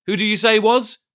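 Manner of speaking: high, loud startled question; suddenly alarmed landlord; sharp demand. A narrator's reading. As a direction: snap the question out. Silver demands urgently.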